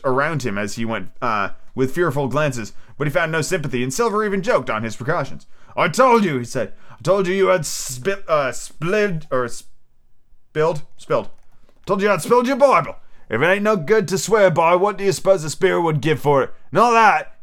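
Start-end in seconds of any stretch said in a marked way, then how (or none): 5.8-17.4 mocking jeer. A narrator's reading. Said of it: "snapped his big fingers".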